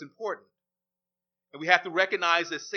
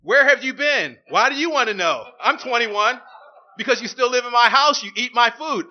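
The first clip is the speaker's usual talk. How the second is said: loud, higher exchange; acted quarrel; 3 semitones higher, 8 dB louder than usual. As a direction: play both sides of a heated argument. Parent and child quarrel acted.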